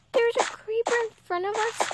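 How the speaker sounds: high panicked cry. Real, not acted. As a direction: cry out in alarm. Panicked at creeper.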